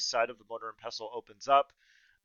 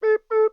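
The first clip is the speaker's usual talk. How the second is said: silly beep; 11 dB louder than usual. Pretend.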